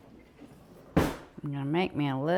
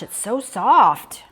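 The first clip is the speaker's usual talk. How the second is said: awed, slow delight; 3 semitones higher, 7 dB louder, much slower than usual. Real, not acted. Marveling at soft fudge.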